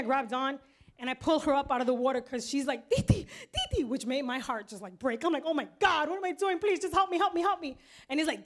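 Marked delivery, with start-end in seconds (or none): 2.9-3.8 imitated high-pitched child calling out; child's desperate call. Niece crying out for her aunt. 5.4-7.8 higher, urgent begging; panicked plea. Retold or imitated.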